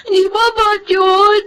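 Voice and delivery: high sobbing questions, slowing at the end; crying. Acted. Child sobbing to her nanny, hurt and confused. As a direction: weep it out in disbelief. Child upset about father's affair.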